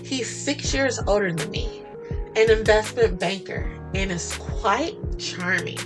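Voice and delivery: ordinary delivery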